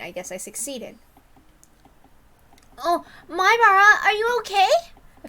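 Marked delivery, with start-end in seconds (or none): 2.8-4.7 put-on high, louder girl voice; concerned schoolgirl. Girls asking the late boy if he's okay. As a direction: run up worried to a classmate.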